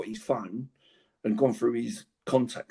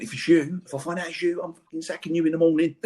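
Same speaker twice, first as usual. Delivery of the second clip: higher, louder mock threat; fuming threat; 5 semitones higher, 4 dB louder than usual. Manager threatening the new lad from non-league, mock fury. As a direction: play the furious manager threatening to sack the player. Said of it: "proper fuming".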